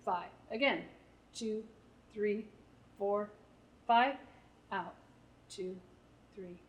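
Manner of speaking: slow paced breathing count for the group; counting breaths. Shown as a demonstration. Therapist counting breaths for parents, slow and soft. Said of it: "I'm gonna count to five".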